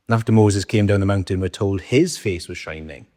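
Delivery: ordinary talk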